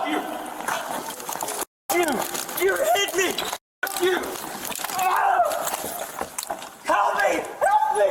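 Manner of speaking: high panicked shout; screaming for help. Real, not acted. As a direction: scream it at full pitch. Screaming for help during arrest.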